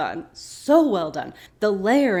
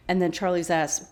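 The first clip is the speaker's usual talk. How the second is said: normal talking voice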